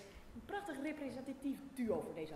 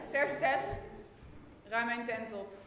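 loud order; 6 dB louder than usual. Acted. Barking orders to a servant.

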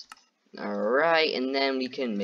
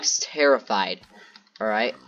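ordinary talk

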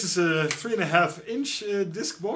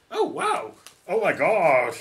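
mock gush; 4 semitones higher, 6 dB louder, slower than usual. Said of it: "you go like".

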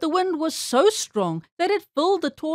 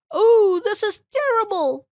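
high, loud wail of dismay; alarmed cry; 9 semitones higher, 5 dB louder than usual. A narrator's reading. Lady pig blown by the wind, crying out in alarm. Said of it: "cried the lady pig".

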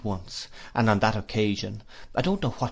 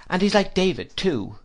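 much higher, settling the matter; decisive; 9 semitones higher, 3 dB louder than usual. A narrator's reading. Aunt settling it with Dick, decisive. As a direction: close the question firmly. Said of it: "said my aunt decisively".

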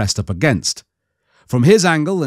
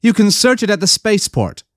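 higher, brisk orders from a woman; commanding woman; 9 semitones higher than usual. Acted. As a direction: give orders without debate. Mara overrules, much higher.

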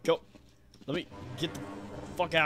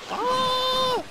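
a sudden high shriek of fright; startled scream; 6 dB louder than usual. Real, not acted. Gamer jolts when an enemy jumps him, shrieking. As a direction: yelp in real panic.

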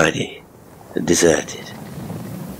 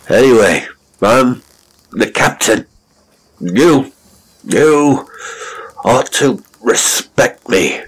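put-on loud, high drunken insistence; drunken indignant shout; 6 semitones higher, 5 dB louder, slower than usual. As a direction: demand respect, drunk and loud.

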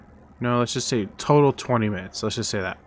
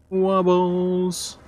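silly high Wobbles; 8 semitones higher, 3 dB louder than usual. Pretend. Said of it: "Just call me Wobbles".

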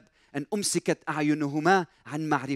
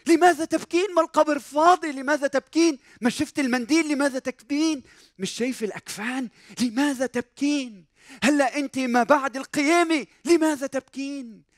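insistent challenge; 7 semitones higher than usual. Acted. Angels challenging weeping Mary after the resurrection, high and insistent. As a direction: press the question over and over. Preacher presses the angels' question.

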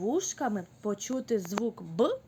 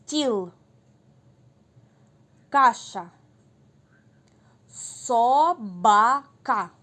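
each word called out loud and clear; emphatic loud teacher word calls; loud word dictation; 8 dB louder, much slower than usual. Shown as a demonstration. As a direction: call words so children can clap on the b. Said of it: "послухайте, в яких же словах".

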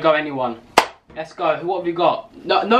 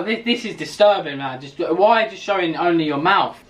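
ordinary talk, nothing marked

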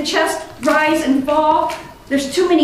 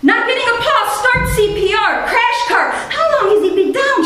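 a higher, urgent, clipped-off nurse's command; nurse snapping; 6 semitones higher than usual. Retold or imitated. Nurse snaps orders at the medic, urgent and higher. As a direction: bark the orders like a nurse in a crisis. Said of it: "she snaps at me".

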